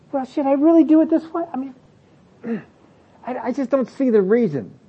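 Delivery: high, hesitant questioning voice; mock doubt, pitched well up; doubting excuse-maker. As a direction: imitate someone talking themselves out of it. Acting people reasoning out of obedience.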